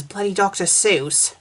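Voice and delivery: ordinary talk, nothing marked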